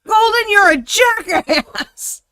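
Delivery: high, loud, drawn-out squeal; slow, squealed name-calling; playful outrage. Real, not acted. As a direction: shriek the insult in mock indignation. Playful insult to a friend.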